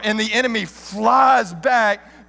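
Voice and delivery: ordinary delivery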